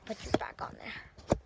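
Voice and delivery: normal talking voice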